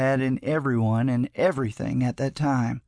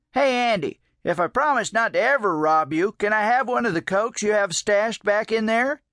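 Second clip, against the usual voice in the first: old man lightly voiced, higher and coaxing; 7 semitones higher than usual. A narrator's reading. Old man asks Andy for a coke, lightly coaxing. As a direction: try a friendly new tack, pitched up.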